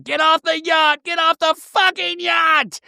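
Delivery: very high, strained mock yell; imitated frantic high-pitched yelling; Heather. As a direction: yell the order in a high voice, stretching the end. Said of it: "you hear Heather yell".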